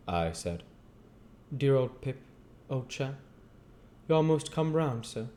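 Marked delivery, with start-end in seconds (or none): 1.6-5.3 higher, fond and humble; gentle working man, affectionate; kindly Joe voice. Acted. Joe answers Pip.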